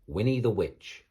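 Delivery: plain reading voice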